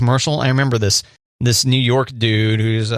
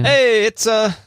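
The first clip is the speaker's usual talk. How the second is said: a botched, higher New York guy voice that falls apart; failed New York accent; 10 semitones higher, much slower than usual. Acted. Radio host trying a New York commercial guy's accent and giving up. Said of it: "I can't do a New York accent".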